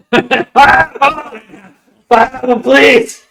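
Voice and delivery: high, loud, slow cry of pineapple through laughter; laughing shout. Real, not acted. Shouting pineapple back at the friend, laughing hard. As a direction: yell it out, cracking up. Excited repeat of the pizza joke.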